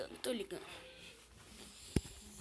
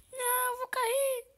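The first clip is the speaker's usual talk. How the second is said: scared cry. Pretend. Falling off skate.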